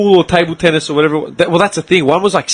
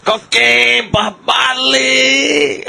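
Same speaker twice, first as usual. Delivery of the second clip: much higher, questioning dealer voice; drunk street dealer; 11 semitones higher, much slower than usual. Retold or imitated. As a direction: offer drugs like a drunk stranger. Drunk dealer repeating offer.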